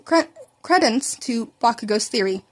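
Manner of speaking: plain reading voice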